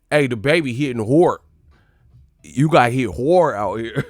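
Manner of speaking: loud "hard" with an O, like "hord". Shown as a demonstration. Joking about the baby, "hard" said as "hord". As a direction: round "hard" into "hord", loudly. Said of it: "had to put an O in that".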